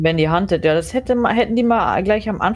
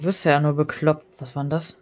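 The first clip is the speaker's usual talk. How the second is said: usual speaking voice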